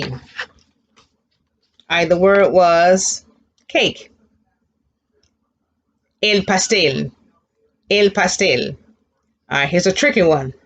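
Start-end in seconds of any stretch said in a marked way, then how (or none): none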